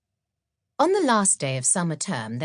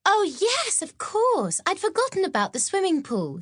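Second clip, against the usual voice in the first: high, eager schoolgirl voice; bright, much higher young friend; Maud's voice. Acted. Maud chatting with Mildred on the train, high and eager.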